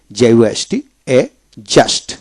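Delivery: usual speaking voice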